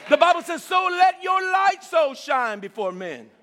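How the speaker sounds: loud, drawn-out preached scripture; preaching. Acted. Preacher calling the verse out to his church. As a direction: proclaim it to the congregation slowly.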